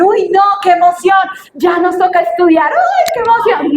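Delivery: high, loud sarcastic glee; mock excitement. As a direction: ham up fake delight. Burla del entusiasmo por estudiar. (entusiasmo fingido, agudo y fuerte)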